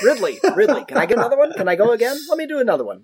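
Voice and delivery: a hint of an actress asking Ridley Scott to go again; Julianne Moore asking for a retake. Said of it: "My, my southern accent was a little horrible".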